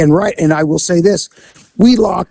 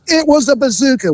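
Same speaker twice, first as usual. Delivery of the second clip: high-pitched, exaggerating store clerk; przerażony sprzedawca; 8 semitones higher than usual. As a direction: mimic an overexcited robbery victim up high. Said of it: "the clerk always goes".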